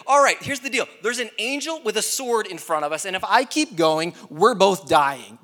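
high, loud, exasperated donkey; talking donkey. Acted. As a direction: lay it out emphatically as the donkey. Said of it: "Donkey's saying all this".

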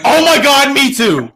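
high, loud gushing excitement; mock fan squealing; excited squeal. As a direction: gush like a thrilled fan. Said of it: "was like".

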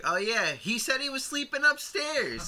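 high, drawn-out put-on reply; imitated movie character. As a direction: stretch it out in a high mock voice. Acts out film character answering.

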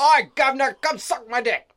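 a jokey Cockney street-tough voice; mock Cockney. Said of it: "not the Cockney kind".

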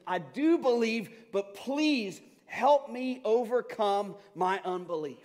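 loud, high, slow desperate cry; father crying out. Acted. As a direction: cry out for help, holding the words long. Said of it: "the Father instantly cried out".

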